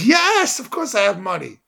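high, loud burst on the first word, then easing; indignant kid insisting he can pay; emphatic assurance. Retold or imitated.

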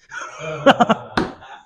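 high laugh at one's own punchline; real laugh. Real, not acted. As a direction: break into a genuine laugh after the joke.

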